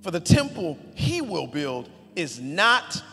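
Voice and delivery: loud, high, slow preaching of the verses; emphatic scripture reading. Acted. Pastor reading King David's speech, loud and slow. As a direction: proclaim it like it ends in an exclamation point. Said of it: "I gotta say it with that, that emphasis".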